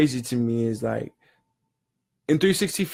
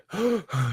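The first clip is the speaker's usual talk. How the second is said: gasp for air; 8 semitones higher, 5 dB quieter than usual. Retold or imitated. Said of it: "he's gasping for air".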